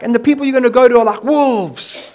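loud, high, hammed-up coach voice; rally the troops like a cheesy motivator; mock upbeat announcement; pep-talk Jesus. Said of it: "worst motivational talk Jesus ever gave".

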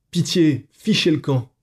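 high pleading cry of fright. A narrator's reading. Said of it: "Clara allait crier".

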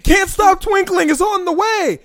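high-pitched mock hero announcement. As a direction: cry it out high, like heralding a hero. Acting a warning villains would hear.

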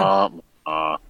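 low, deflated sad-trombone noise; mock dejection. Co-host reacting to the mention of Titanic, mock gloom. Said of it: "is that womp womp of joy".

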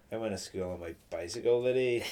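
half-hearted imitation of a Belfast accent; pupil's mumbled Belfast accent; Belfast accent attempt. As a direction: have a poor go at a Belfast accent. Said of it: "say it in your best Belfast accent".

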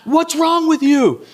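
high mock yell at a lazy son; laughing while imitating dad's yell; father yelling. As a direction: bellow it like an exasperated dad. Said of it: "he yells at me".

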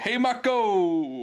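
high, drawn-out fan holler; imitated fan call. Fan calling to Mako at the arena, mock holler. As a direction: call out like an adoring fan, stretched long. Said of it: "there's just this fan going".

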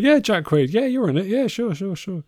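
playfully imitating an offhand producer saying yes to everyone; lightly voiced casual announcer.

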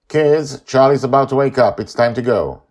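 high, loud call to hurry; calling the kids. A narrator's reading. Grandpa calls the kids off the dream, high and loud. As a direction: round the kids up before Charlie wakes.

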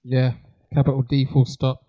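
ordinary talk, nothing marked

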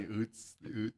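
oots said with a long oo, no y sound; pronounced oots. Shown as a demonstration. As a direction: say oots like boots. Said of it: "It's not utes".

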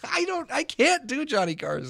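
very high, laughing protest. Real, not acted. Comic admitting his impression failed, high laughing protest. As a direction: squeal it through laughter.

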